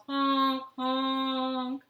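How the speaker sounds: loud honking goose call. Acted. Goose honks at the woman reaching, loud honk. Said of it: "the goose yelled".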